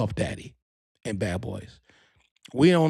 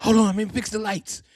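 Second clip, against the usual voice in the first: higher, bossy director voice; 8 semitones higher than usual. Retold or imitated.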